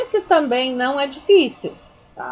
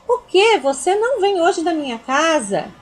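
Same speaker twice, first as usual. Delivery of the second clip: indignant disbelief; 5 semitones higher than usual. Shown as a demonstration. Teacher voicing indignation at a friend not coming over. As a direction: burst out in disbelief. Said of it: "uma frase de indignação".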